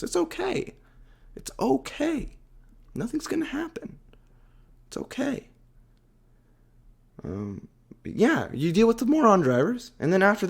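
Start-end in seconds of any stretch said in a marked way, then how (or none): 0.0-5.5 much higher, softer coaxing voice, partly whispered; mock soothing. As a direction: sarcastically comfort a timid driver. Mock coaxing slow driver.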